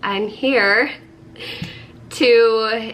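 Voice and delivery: slow, sickly voice. Real, not acted. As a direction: sound sick and stuffed up. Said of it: "in my sick voice".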